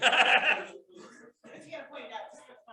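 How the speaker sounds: loud high burst of laughter; big laugh. Real, not acted. Loud laugh at the group's answer.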